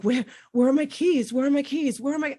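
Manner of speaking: high, strained cries searching for the keys, partly re-enacted; retold frantic panic. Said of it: "started screaming, cry".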